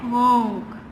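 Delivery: low, drawn-out "हॉन्क"; long low honk; car horn imitation. Shown as a demonstration. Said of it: "कैसा होता है?".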